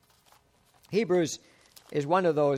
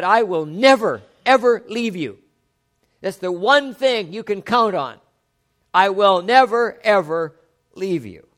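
fervent promise; 3 semitones higher, 6 dB louder than usual. Acted. Preacher quoting the promise fervently.

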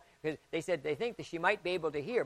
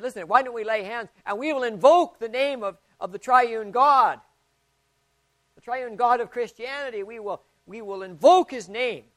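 louder, higher voice calling to prayer; retold rallying call; 6 semitones higher, 10 dB louder than usual. Pastor urging the group to pray, raised voice. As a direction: rouse the room to lay hands. Preacher quoting prayer invitation.

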